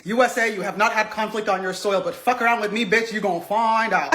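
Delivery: mock Putin threat. Mocking Putin warning the USA, low and menacing. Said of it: "he was trying to say".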